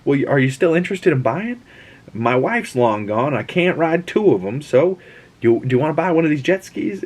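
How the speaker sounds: salesman pitch. Retold or imitated. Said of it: "And then we see him".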